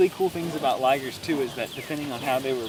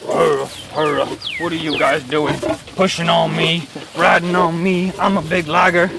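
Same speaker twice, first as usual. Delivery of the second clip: loud big-cat grumbling; big lion voice; 8 dB louder than usual. Retold or imitated. Guide voicing the liger as it walks along, big lion voice. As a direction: boom it out like a lion talking. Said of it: "he has this big lion voice".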